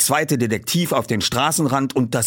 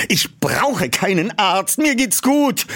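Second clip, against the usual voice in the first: irritated; 9 semitones higher, slower than usual. Acted. Peter refusing help.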